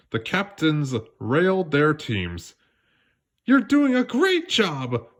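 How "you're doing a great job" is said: high, slow, excited cheer to her team; rally the team with big excitement; exclaiming. A narrator's reading. Captain Jasmine cheering her team between chukkers, excited. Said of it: "exclaimed Jasmine".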